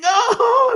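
high-pitched imitated scream; drawn-out high mock "oh" scream. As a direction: imitate panicked passengers screaming. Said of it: "everybody was screaming".

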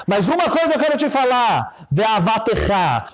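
high-pitched, fervent cry to God; impassioned plea. Retold or imitated. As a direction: rise suddenly into an imploring prayer. Cantor's plea to God, retold. (súplica exaltada, em tom agudo)

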